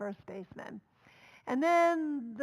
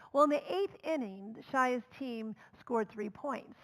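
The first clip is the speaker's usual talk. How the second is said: ordinary delivery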